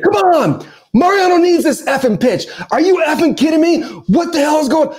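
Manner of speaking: half-shouted imitated catcher tirade; cussing out umpire. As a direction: yell at the umpire like a furious catcher. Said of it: "He's like".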